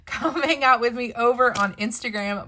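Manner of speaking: laughing through the words. Real, not acted. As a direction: say it while laughing. Laughs after losing her voice.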